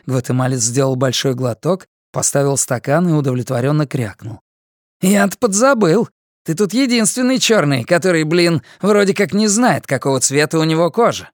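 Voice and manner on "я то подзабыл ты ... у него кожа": higher, sneering junkie voice; mocking inmate. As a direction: taunt him with a smirk. Guatemalan mocks Karl.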